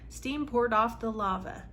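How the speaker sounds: ordinary talk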